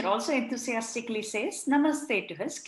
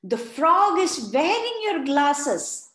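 put-on loud, higher child's warning; urgent child warning; 5 semitones higher, 7 dB louder, slower than usual. A narrator's reading. Farah warns teacher urgently about frog. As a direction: warn him urgently. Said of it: "said Bishal".

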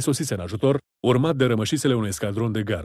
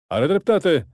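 higher, emphatic agreement; exclamation; 5 semitones higher, 4 dB louder, much slower than usual. A narrator's reading. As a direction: exclaim it over the fighting. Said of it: "exclama Antonio Bocchiardi". (exclamație aprinsă)

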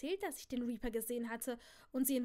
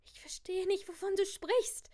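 higher, louder, panicky; frightened confusion; 6 semitones higher, 4 dB louder than usual. A narrator's reading. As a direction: sound rising panic. Sophie scared, confused.

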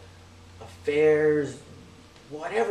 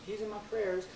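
higher, offhand imitation of a brush-off; mock polite excuse; 6 semitones higher, 6 dB quieter than usual. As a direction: lightly imitate someone politely begging off. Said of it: "it's kinda like".

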